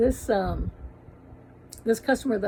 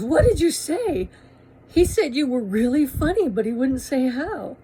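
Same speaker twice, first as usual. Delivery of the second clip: higher, louder eager questions; breathless curiosity; 5 semitones higher, 4 dB louder than usual. Retold or imitated. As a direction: pepper her with questions, bursting to know. Wife rushes in next day.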